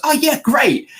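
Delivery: high, thrilled fan voice; eager fan. Retold or imitated. Fan accepting a picture offer, high and thrilled. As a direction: chirp it out delighted. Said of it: "so he goes".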